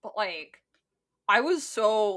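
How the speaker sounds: ordinary delivery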